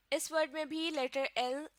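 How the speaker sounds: ordinary delivery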